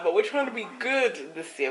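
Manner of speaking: loud and proud resolve; loud declaring. Real, not acted. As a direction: announce it boldly. Repeats the vow twice.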